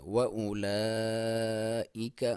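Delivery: وَأُولَئِكَ chanted with a clear hamza; slow recitation. Shown as a demonstration. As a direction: draw it out like a reciter. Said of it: "یہاں پہ آپ حمزہ کو clear کریں گے".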